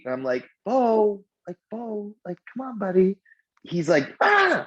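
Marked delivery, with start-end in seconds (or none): 0.7-3.2 mild coaxing call. Acted. Dad first calling his son back from the street, mild coaxing call. 4.2-4.7 very high, loud imitated kid's cry; child's squeal. Imitating the son running into the street, child's squeal. Acts out heedless son's squeal.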